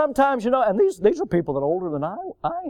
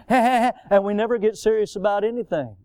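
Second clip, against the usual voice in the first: ordinary delivery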